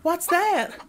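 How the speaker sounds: put-on high, sudden little-girl cry; a child jolted awake by a noise; startled cry. A narrator's reading. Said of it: "cried Dorothy".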